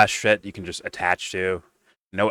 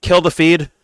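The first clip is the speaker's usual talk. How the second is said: higher, louder hammed-up panic; mock panic; 10 semitones higher, 8 dB louder than usual. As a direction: play a host caught out, panicking. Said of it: "And they".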